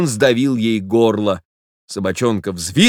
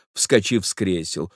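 ordinary delivery

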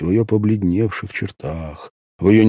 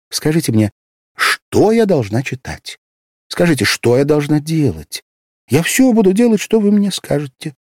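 put-on higher, pleading girl full of trust; innocent trust; 9 semitones higher, 5 dB louder than usual. A narrator's reading. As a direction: hand him your trust like a child. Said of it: "с невинной доверчивостью".

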